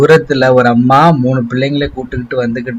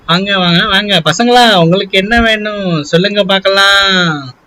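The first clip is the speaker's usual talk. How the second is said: cheerful ice cream vendor; 4 semitones higher than usual. Acted. Vendor calling customers over.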